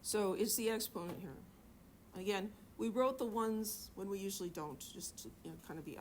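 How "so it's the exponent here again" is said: normal talking voice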